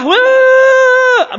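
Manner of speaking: a high, loud wah of alarm; sudden outburst. Acted. Preacher mocking a wife who flares up at once, loud high outburst. Mock sudden anger after marriage.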